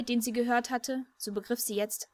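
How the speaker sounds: ordinary delivery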